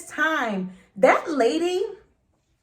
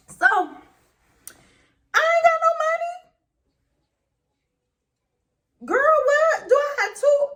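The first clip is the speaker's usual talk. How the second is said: much higher, half crying, half laughing disbelief; exasperated sass; 14 semitones higher, 5 dB louder, slower than usual. Retold or imitated. Mocking the officer's bail question, exasperated high squeal. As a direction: squeal it like the demand is ridiculous.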